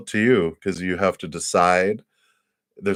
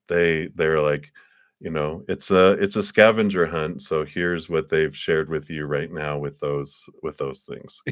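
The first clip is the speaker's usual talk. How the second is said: usual speaking voice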